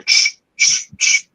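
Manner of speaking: crunching leaf noise. Retold or imitated. Telling hosts how silent footsteps should have sounded, whispered crunch. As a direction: breathe out the crunch of footsteps on dry leaves. Imitating leaves crunching underfoot.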